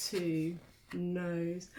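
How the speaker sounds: usual speaking voice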